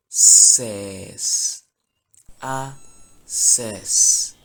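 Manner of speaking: loud "ses" sounded out; sounding out "a ses" slowly for learners. Shown as a demonstration. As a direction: split it up: "a", then "ses". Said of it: "So it will be".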